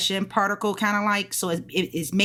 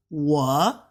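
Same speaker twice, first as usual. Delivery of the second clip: 我 gliding up like a question; drawn-out 我 that climbs at the end; rising tone. Shown as a demonstration. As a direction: lift 我 upward as a second tone. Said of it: "which means it'll go up".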